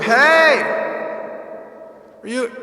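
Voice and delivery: ordinary delivery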